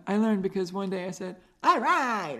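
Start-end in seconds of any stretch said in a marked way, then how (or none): 1.6-2.4 loud, higher cartoon-mom catchphrase; Linda Belcher. Host showing the impression she discovered, loud cartoon voice. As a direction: belt it out in a cartoon voice. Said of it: "I could do Linda Belcher's voice".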